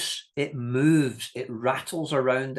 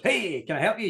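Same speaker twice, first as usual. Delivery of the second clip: chirpy shop staff; 9 semitones higher than usual. Retold or imitated. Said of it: "if a member of staff goes".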